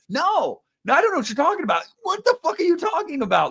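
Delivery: high-pitched, exaggerated bafflement; mock incredulous denial. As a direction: act dumbfounded in a high put-on voice. Mocks anybody denying the tonal shifts.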